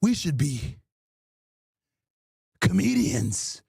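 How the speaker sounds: low, slow mock dim voice; dumb deep voice. The duo decides to become comedians, low and slow. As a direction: drawl it out like a dim idea.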